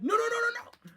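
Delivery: high, loud panicked no's. Retold or imitated. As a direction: scream no in terror as you shrink. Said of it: "he's like".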